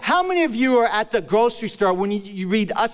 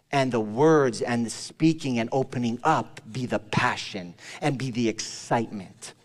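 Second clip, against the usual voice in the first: quiet earnest preaching; 6 semitones lower, 3 dB quieter than usual. Real, not acted. Preacher quietly urging brothers and sisters, earnest and soft. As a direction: draw the congregation in gently. Sermon close turns softer.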